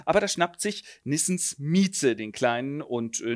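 normal talking voice

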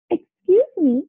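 high-pitched interrupting call; mild shout. A narrator's reading. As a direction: pipe up to cut in. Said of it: "a voice shouted".

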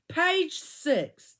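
ordinary delivery

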